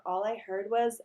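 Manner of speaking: plain reading voice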